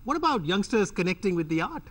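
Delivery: normal talking voice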